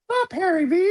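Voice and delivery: squeaky high puppet voice. Retold or imitated. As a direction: pitch way up like a hand puppet. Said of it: "You ain't gotta like that voice".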